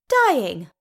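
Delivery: loud, incredulous repeat; scornful echo. A narrator's reading. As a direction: throw the word back with scorn. Dees scornfully echoes her brother's word.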